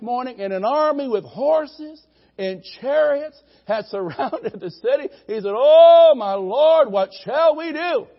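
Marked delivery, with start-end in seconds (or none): 5.5-8.1 high, loud cry of alarm; panicked servant. Retold or imitated. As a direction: gasp it out in a panic. Terrified servant voiced in story.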